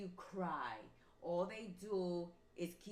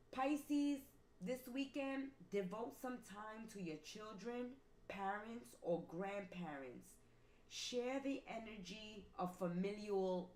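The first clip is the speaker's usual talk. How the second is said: angel message read; 3 semitones higher than usual. Acted.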